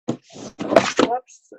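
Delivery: breathy mock-guilty whoops, way up; a cheeky little oops after a brag; sheepish jokey aside; playful whoops. Real, not acted. Bragging about going silver.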